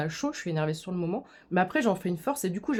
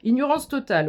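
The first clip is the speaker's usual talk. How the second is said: 1 mock indignation; 6 semitones higher, 6 dB louder than usual.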